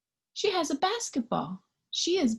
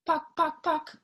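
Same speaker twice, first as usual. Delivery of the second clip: pin sounds; 5 semitones higher than usual. Acted. Juggling pins noise.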